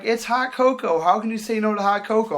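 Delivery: high imitation of disbelief; mock pleading. Girl amazed he refuses cocoa, high mock pleading.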